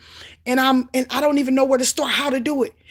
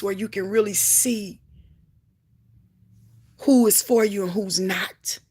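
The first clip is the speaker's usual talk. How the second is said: whisper; 4 semitones lower than usual. Real, not acted. Quiet sermon aside.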